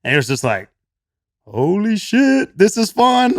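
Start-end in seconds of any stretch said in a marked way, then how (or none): 1.4-3.4 slow, high, amazed delight; drawn-out and much higher, awestruck at the fun; excited. Retold or imitated. Euphoric vision, party.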